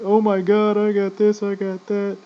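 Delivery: high, loud mocking brag; mock bragging gun nut. Mocking friends who bragged about their gear, high and loud. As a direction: parody the ones showing off their gear. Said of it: "most of them were like".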